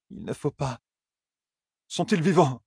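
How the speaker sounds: worried to hear it; anxious Rand. A narrator's reading.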